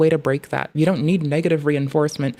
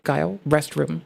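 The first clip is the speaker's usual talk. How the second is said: mild stern command. Retold or imitated. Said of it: "intonation in my voice would discern".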